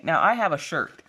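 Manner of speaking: normal talking voice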